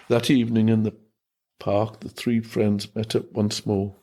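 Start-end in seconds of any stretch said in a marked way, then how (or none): none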